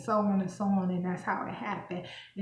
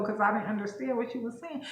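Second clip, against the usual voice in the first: ordinary talk, nothing marked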